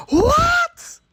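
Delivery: loud, very high screech of disbelief; piercing incredulous squeal; shrieked disbelief. Retold or imitated. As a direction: shriek it in outraged astonishment. Outraged disbelief at being asked to cry.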